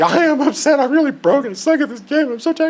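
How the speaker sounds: very high, loud hammed-up whining; comic high-pitched meltdown; mock upset wail. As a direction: wail it like an exhausted, overwrought loser. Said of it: "You seem a little upset".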